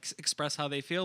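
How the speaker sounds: ordinary talk, nothing marked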